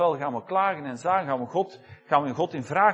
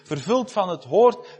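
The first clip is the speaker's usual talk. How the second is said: usual speaking voice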